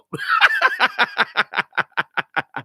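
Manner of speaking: long high giggling laugh. Real, not acted. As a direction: giggle high and let it run on. Laughing at absurd chat.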